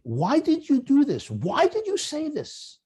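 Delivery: high, slow, curious why; curious asking. Shown as a demonstration. Husband asking his wife, curious and high. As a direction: ask it wondering, not accusing. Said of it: "I ask with curiosity".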